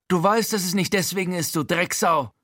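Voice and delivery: high, hissed insult; zischend. A narrator's reading. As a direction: hiss it with contempt at your old landlord. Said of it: "zischt Frankie". (wütendes Zischen in hoher Stimme)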